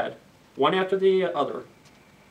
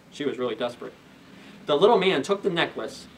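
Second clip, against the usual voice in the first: ordinary delivery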